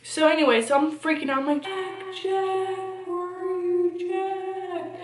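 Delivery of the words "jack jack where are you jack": higher, softer retold call for a lost dog; mild mock panic calling Jack; frantic calling. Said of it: "calling his name frantically".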